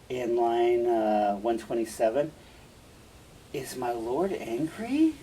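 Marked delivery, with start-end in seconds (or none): 3.5-5.2 slow question, lifting at the end; feigned ignorance. Acted. Iago acts as if unaware of Othello's anger, feigned ignorance. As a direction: play innocent, drawing out the question. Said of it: "he plays like he doesn't know".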